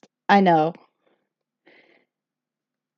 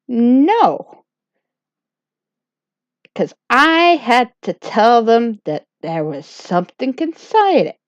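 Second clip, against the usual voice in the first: usual speaking voice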